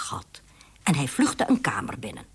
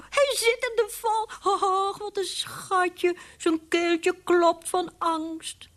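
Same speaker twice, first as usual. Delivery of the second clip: put-on high, drawn-out cooing child voice; little girl; 14 semitones higher, much slower than usual. A narrator's reading. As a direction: coo over the trapped mouse as a child. Little daughter finds mouse in trap.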